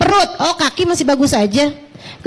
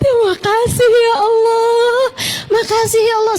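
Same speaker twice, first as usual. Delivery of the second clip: put-on grateful old patient; 8 semitones higher than usual. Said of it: "Dia bilang".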